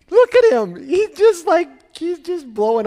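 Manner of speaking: high, loud gushing pride; proud delight. Pretend. God gushing over his son, high and loud. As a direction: gush like a proud father.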